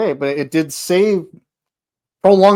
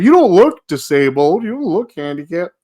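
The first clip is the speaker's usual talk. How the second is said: imitated accuser; 5 semitones higher, 5 dB louder than usual. Mimicking people who challenge them.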